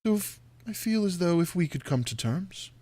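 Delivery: deliberate, courtly villain; Strahd bargaining. Acted. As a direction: make the offer in measured, halting phrases.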